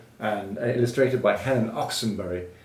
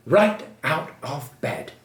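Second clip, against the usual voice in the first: ordinary delivery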